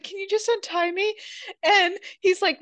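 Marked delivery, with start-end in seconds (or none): 0.0-1.1 pleading woman. Retold or imitated. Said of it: "and she's like".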